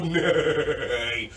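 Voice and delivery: a calm breathy neigh; low horse neigh. Shown as a demonstration. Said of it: "take a big belly breath in and go".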